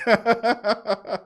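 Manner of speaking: real burst of laughter; amused laugh. Real, not acted. Host laughing at a chat comment, amused laugh. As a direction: crack up for a second.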